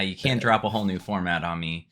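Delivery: normal talking voice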